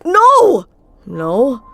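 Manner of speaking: sudden high, loud "No!"; shouted denial. Acted. Felix cornered about his crush, shouts a panicked no. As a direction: blurt out a panicked no. Caught, denies loudly.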